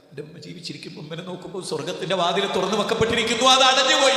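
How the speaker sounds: rising fast into a high anguished wail; anguished cry. Acted. Son cries out to the judge in court, a high anguished wail. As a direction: cry out that heaven's door is shut.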